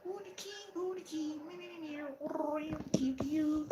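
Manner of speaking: very high, soft cooing pet voice; baby talk to cat. Acted. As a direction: coo at the kitty like a baby.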